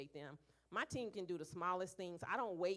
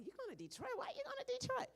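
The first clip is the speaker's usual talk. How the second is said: much higher, disbelieving voice; incredulous question; faster than usual. Retold or imitated. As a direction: sound shocked anyone would go. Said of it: "people was like".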